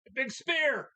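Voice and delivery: sudden high loud shout; excited call. Real, not acted. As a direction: yell the big move out. Calling a wrestling move live.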